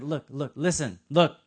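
usual speaking voice

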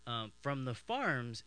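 ordinary delivery

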